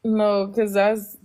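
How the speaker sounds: ordinary delivery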